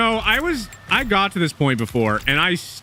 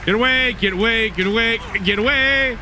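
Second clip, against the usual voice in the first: high panicked yelling; 10 semitones higher, 3 dB quieter than usual. Real, not acted. Fleeing chaser in game.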